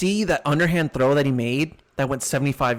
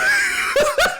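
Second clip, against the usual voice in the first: high loud laughing shriek; cracking up mid-story; burst of laughter; 6 dB louder than usual. Real, not acted. Laughing hard while retelling the Robbie Anderson clip, loud high laugh.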